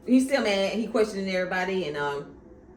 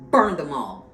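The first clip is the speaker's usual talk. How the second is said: quoted command; 9 dB louder than usual. Retold or imitated. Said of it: "the Mad King said".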